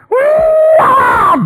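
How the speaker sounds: huge high laughing whoop; joyful whoop. Acted. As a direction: whoop with joy at the sight. Dad sees son returning.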